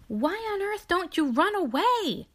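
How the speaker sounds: high loud horrified exclaim; shocked and alarmed. Acted. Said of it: "exclaimed the horrified Pigling".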